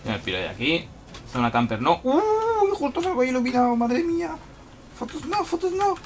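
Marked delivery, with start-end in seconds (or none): 2.0-6.0 much higher, louder, fast frantic squeak; high panicked squeal. Real, not acted. Lit up by an enemy flashlight, frantic high squeal. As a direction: squeal like you're caught red-handed.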